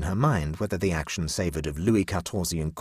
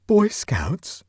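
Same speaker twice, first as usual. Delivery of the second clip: much higher, louder disbelieving echo; aghast; 13 semitones higher, 5 dB louder than usual. A narrator's reading. Huddle hearing of Boy Scouts, shocked high echo.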